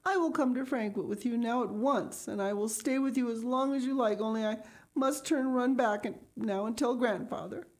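put-on high, pleading child's voice, lightly tearful; sobbing out. A narrator's reading. Heidi begging the doctor to take her, mild sobbing child. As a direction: plead through mild tears. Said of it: "she sobbed out".

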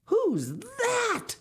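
very high, loud frightened shriek; panicked high yelp. Acted. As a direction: shriek at the monster coming. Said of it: "shrieked the boys".

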